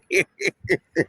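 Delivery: a short falling chuckle; laughing it off; amused laugh. Real, not acted. Laugh after being left.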